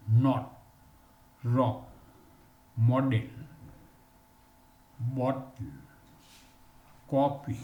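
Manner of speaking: "not, rock, model, bottle, copy" with a short o, spaced apart; short o drill. Shown as a demonstration. Teacher modelling the short o for students, careful drill. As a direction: say each word with a rounded short o.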